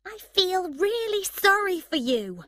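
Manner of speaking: high, loud, biting mock sympathy; angry sarcasm. A narrator's reading. Emma sarcastically pitying Dexter, angry. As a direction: let the fake pity cut. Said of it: "Emma said angrily".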